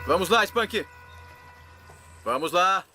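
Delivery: loud urging call to an animal. Real, not acted. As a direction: shout encouragement across the water. Calling the alligator to bait.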